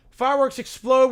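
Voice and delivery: ordinary delivery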